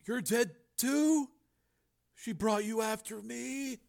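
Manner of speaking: high, soft, slow dread; hushed horror. A narrator's reading. Brother thinks Frank is dead, hushed horror. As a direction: let the horror sink in slowly. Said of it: "a deeper horror settled on Joe's face".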